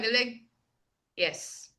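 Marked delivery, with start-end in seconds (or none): 1.2-1.7 low, very soft, toneless yes; unexpressive yes. Retold or imitated. Vietnamese people answering whether they are enjoying, unexpressive. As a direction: imitate a barely audible, unenthusiastic answer. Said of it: "not able to comprehend that you're enjoying".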